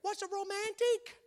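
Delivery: husband mimicking wife's eager question; imitating wife. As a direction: ask it as the hopeful wife. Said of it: "And Angie will say".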